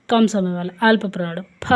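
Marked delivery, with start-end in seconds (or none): 1.6-1.7 फ said slowly with an easy breath of air; slow relaxed pha. Shown as a demonstration. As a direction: ease out फ slowly, breath flowing. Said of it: "आराम से बोला इसको". (फ आराम से, धीरे बोलें)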